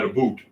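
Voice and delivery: "boot" with a long oo in place of about; Canadian "a boot". Shown as a demonstration. Showing friends the Canadian pronunciation, "a boot" for about. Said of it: "A Canadian would pronounce that".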